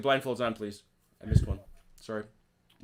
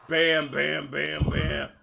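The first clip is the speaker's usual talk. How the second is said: gunfire bams; 7 semitones higher, 8 dB louder, much slower than usual. Retold or imitated. Imitating gunfire hitting the ship.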